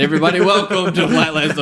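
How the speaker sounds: announcer-style welcome; podcast host opening the show; podcast intro. Acted. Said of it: "may the tangents begin".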